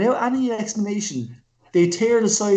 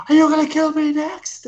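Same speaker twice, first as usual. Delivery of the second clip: mock nervous question; 10 semitones higher, 4 dB louder than usual. Acted quote of his reaction.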